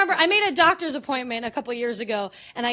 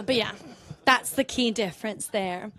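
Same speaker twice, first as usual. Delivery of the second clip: usual speaking voice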